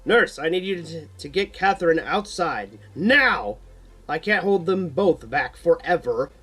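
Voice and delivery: ordering. A narrator's reading. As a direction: bark orders mid-fight. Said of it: "orders Keith".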